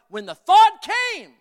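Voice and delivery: high, drawn-out shout to the congregation; emphatic preaching shout. Real, not acted. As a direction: cry it out slowly at full force. Preacher hammering his point home.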